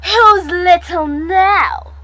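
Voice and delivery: loud, drawn-out snarl of triumph. A narrator's reading. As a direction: throw it out high and loud at a beaten rival. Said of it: "I snarled".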